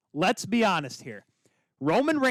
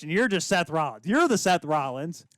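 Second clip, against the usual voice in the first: ordinary delivery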